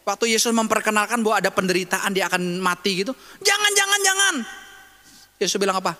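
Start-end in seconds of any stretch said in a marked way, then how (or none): 3.4-4.3 pleading Peter. Retold or imitated. Peter begging Jesus not to die, higher pleading. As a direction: beg him not to go, pitched up.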